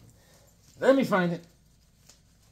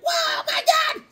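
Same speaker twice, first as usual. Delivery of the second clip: very high, hammed-up shriek; squealing crowd alarm; panicked onlookers; 14 semitones higher, 6 dB louder than usual. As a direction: shout in mock terror at a giant. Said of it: "People are like".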